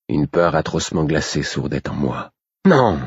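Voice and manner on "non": sharp high cry of fear and anger; a sudden frightened no; frightened angry cry. A narrator's reading. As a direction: blurt it out in dread. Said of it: "Sa peur se mêlait à présent de colère".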